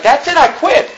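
a light sketch of a prophet who has had enough; fed-up Elijah. Retold or imitated. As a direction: throw up your hands and give up. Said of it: "He's like".

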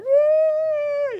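very high mock toddler cry; long put-on squeal of a caught toddler; imitated toddler wail. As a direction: wail like a self-pitying little kid. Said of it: "the toddler who gets caught".

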